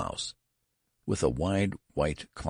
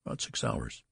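low, soft, hushed reply; considered answer; 6 semitones lower, 6 dB quieter than usual. A narrator's reading. Father tells the clerk his child's age, low considered answer. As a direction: answer quietly, with due consideration. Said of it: "answered Mister Button with due consideration".

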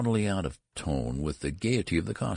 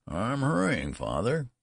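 put-on lightly higher, meek old son; high old-man son voice; 7 semitones higher than usual. Son replying to his father, lightly higher and meek. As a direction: answer him like a dutiful old child.

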